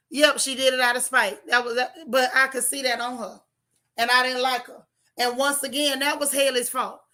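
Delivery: ordinary delivery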